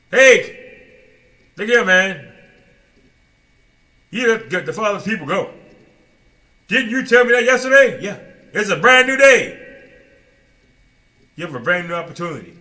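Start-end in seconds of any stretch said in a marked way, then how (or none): 0.1-9.6 much louder, higher call; bold confrontation. Retold or imitated. Moses telling Pharaoh to free the people, loud. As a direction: stride in and confront.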